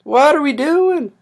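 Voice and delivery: high, loud wail of frustration; exasperated cry. Real, not acted. Streamer stuck on a broken setup, exasperated cry. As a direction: throw it out loud and high, at a loss.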